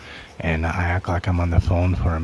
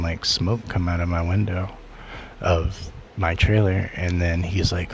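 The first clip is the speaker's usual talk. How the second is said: usual speaking voice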